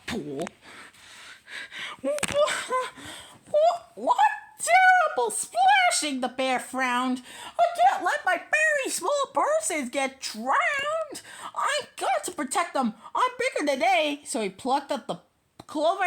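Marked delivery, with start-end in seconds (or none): 2.0-6.2 high, loud startled what, what; startled cry. A narrator's reading. Narrator voicing alarm at the splash. 7.6-14.2 light, higher, quick bear voice with a laugh; a hint of a fretful big bear; protective bear. Bear protecting the small persons, lightly voiced. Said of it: "bear speaks, story voice".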